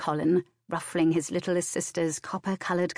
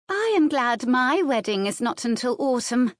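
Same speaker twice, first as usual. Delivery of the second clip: louder, higher, self-satisfied; a touch smug; 7 semitones higher, 5 dB louder than usual. A narrator's reading. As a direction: gloat slightly, pitch lifted. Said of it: "a touch smugly".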